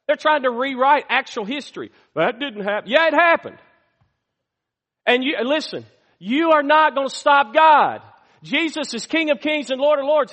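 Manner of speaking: high, loud fiery preaching; preaching at full force. Acted. Pastor rousing the church against the rewriters, high and loud preaching. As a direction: thunder it from the pulpit.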